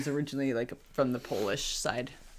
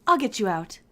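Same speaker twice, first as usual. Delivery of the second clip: high character voice for the rescuer; rescuer's voice; 9 semitones higher than usual. A narrator's reading. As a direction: pitch it up to play the rescuer.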